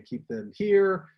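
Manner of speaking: public speaking coach advice. Retold or imitated. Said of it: "they might say".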